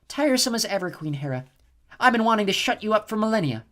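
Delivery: a cruel goddess savouring her plan; cold snow goddess. A narrator's reading. Chione answering Hera.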